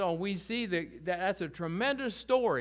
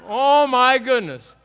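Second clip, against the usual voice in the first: a loud drawn-out oh my goodness; amused exclaim; 4 semitones higher, 13 dB louder, slower than usual. Real, not acted. Teacher amused by Bible verses naming grumblers, loud slow exclaim.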